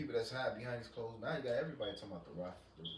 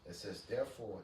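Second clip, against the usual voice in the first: scripture reading; 3 semitones lower, 6 dB quieter than usual. Acted. Reads Isaiah aloud.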